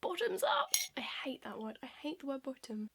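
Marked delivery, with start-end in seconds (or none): 0.0-0.7 high little singsong toast; mock-cheery toast. Real, not acted. Toast before a drink.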